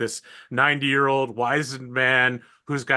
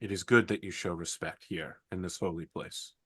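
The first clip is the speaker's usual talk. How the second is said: solemn sister voice; 3 semitones lower, 3 dB quieter than usual. Acted. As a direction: speak low and grave like a holy sister. Sister speaks in the holy place.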